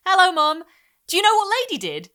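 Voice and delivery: put-on high, loud eager little girl; excited child. A narrator's reading. Little girl telling mum excitedly. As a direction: burst in bursting with news.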